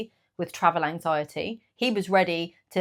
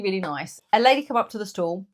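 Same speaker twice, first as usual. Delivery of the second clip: ordinary delivery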